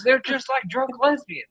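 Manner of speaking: high-pitched exasperated verdict; imitated griping old angler. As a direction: act out the old guy's complaint. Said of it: "He goes".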